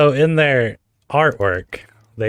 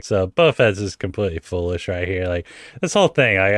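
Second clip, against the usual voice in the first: ordinary talk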